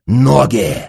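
loud, straining hoarse order; hoarse croak. A narrator's reading. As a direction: rasp it out at full force. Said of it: "прохрипел будочник". (громкий хрип)

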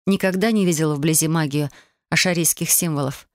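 plain reading voice

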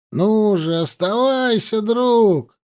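high-pitched coaxing call; urging. A narrator's reading. Tent companions begging their friend to stay, urging. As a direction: coax him back, pitch lifted.

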